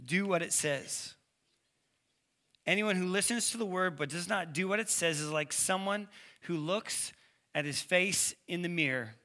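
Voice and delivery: ordinary talk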